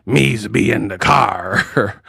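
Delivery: mock pirate, slow and drawn out; Clarissa Shields. YouTuber mocks a boxer's accent, pirate impression. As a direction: drag the words out like a hammed-up pirate. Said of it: "I hate her accent".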